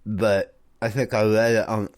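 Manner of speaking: talking while holding tongue; tongue held, words slurred. Real, not acted. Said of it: "I can't talk with while I'm holding my tongue".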